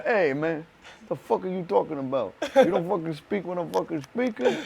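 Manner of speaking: a hint of a tough Italian New Yorker boss; lightly voiced mob boss putting someone in his place; mild mock gangster telling you to shut up; Italian New York mobster. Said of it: "I think of this fucking guy in a suit, cigar, telling me".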